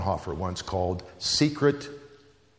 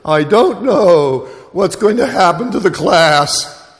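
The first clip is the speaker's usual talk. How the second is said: much higher and louder, slow, voice quivering; trembling voice; 10 semitones higher, 12 dB louder, slower than usual. Retold or imitated. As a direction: near tears, barely holding it together. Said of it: "and her voice trembled".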